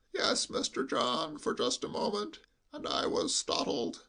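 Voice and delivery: hushed, high sheep-like bleat. Acted. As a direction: bleat it confidentially, as through cotton wool. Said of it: "in a lowered voice".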